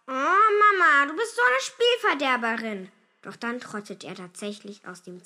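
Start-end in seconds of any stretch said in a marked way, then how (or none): 0.1-2.9 sulky protest, stretched at the start; whining child. A narrator's reading. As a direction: whine at a spoilsport parent. Boy whines at mother.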